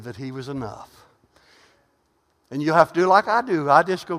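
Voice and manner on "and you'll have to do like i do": ordinary delivery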